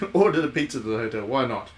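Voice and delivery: normal talking voice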